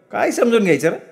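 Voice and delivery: high-pitched exasperated comeback; retorting mother. Acted. Mother answering her son's criticism, exasperated.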